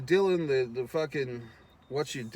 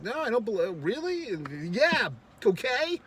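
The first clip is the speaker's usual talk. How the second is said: much higher, incredulous mock voice; mock disbelief; 9 semitones higher than usual. Mom doubting the kids' killing story, high mock disbelief. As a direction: play the mom scoffing in a high voice.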